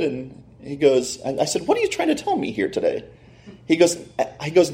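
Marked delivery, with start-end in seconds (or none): 1.7-3.0 high, soft, anxious question; anxious disbelief. Retold or imitated. Autograph owner questions the card dealer, anxious. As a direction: ask it pitched way up, dreading the answer.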